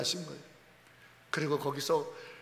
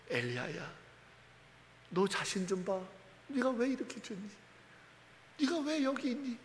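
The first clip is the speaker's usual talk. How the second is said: still small voice; 2 semitones higher, 9 dB quieter than usual. Acted. Preacher voicing God calling Elijah in a soft small voice. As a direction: hush it like a soft call.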